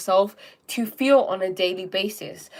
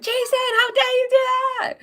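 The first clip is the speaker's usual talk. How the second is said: high-pitched mock-furious screech; hammed-up outraged yell, far higher; mad at brother; 5 dB louder than usual. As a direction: snap straight back into shrieking at your brother. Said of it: "You choose to be mad".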